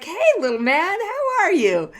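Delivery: put-on high, sing-song greeting to a toddler; sweet grandma voice. Grandma in line greets toddler, high sweet coo. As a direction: coo at a little boy like a kind grandma. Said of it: "And she's like".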